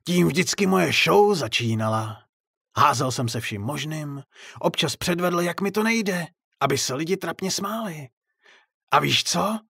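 high, theatrical clown voice; clown's monologue. A narrator's reading. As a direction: reminisce on stage with rising bitterness. Clown monologue in his act.

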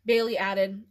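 plain reading voice

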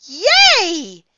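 high, loud delighted squeal; squeal of joy. A narrator's reading. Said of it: "Andrea squealed".

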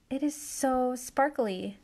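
usual speaking voice

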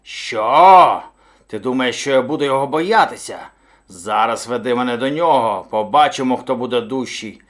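loud bellow of disbelief, then boasting; booming angry bravado; indignant bear. A narrator's reading. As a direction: scoff and command as an offended bear. Bear scoffs at hare's warning.

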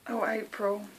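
usual speaking voice

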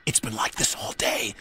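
soft whispered aside; little engine confiding to his friend. Acted. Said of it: "confided Percy to Thomas".

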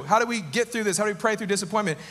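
ordinary talk, nothing marked

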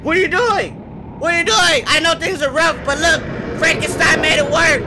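high frantic questioning; panicked. Real, not acted. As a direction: freak out at the monster. Confronting monster, high pitch.

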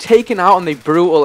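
loud, high-pitched dismay; dismayed outburst. Real, not acted. Gamer killed on a big streak, dismayed outburst.

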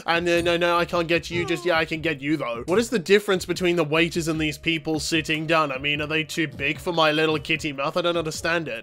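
usual speaking voice

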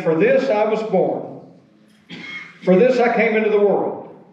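solemn, emphatic reading, pausing between phrases; scripture reading. Acted. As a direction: proclaim each phrase, starting high and falling to a close. Reads scripture aloud to congregation.

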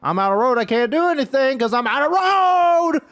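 high, loud real frustration; overwhelmed wailing. Real, not acted. Gamer out of road and overwhelmed, wailing. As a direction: wail it at the top of the range.

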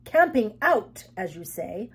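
high, loud, affronted guest; indignant prim guest. Acted. Guest refusing her lodgings, indignant. The Mop complains, character voice.

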